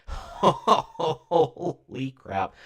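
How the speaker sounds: high, laughing, drawn-out holy crap; laughing shock. Real, not acted. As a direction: burst out laughing in disbelief. Reacting to character photos.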